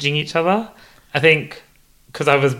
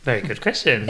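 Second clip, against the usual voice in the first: ordinary talk, nothing marked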